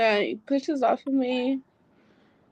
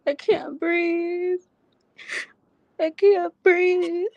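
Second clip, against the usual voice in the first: choked up and tearful, slow and higher; real tears breaking through; crying; 6 semitones higher, slower than usual. Real, not acted. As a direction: sob the words out, short of breath. Reliving being strangled, host comforts.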